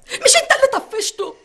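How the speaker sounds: accusing. Acted. Mother blames father for son.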